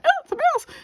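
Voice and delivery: very high yelp of fright; startled shriek. Retold or imitated. Woman yelps as the mouse steps toward her, high frightened squeal.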